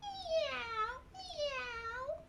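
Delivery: very high, soft meows; tiny kitten mews; cat meow. Shown as a demonstration. Reviewing the cat for the children with high meows. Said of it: "We have cat".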